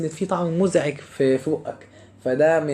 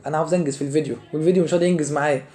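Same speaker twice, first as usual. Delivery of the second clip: ordinary talk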